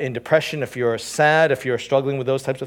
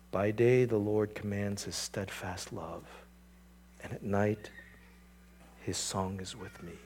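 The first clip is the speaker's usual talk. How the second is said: much softer, slow tender verse; hushed reverent quote; 5 semitones lower, 12 dB quieter than usual. Shown as a demonstration. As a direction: breathe the verse as comfort. Writer's words to himself.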